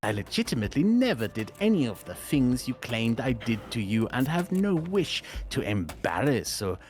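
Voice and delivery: normal talking voice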